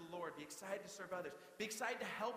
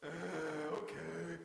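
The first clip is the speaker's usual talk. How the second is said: slow drawn-out uh okay; mock the teenage sigh of giving in; sulky teen; 3 semitones lower, 3 dB louder, much slower than usual. Teen to parents asked to do a chore, sulky. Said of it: "I'd be like".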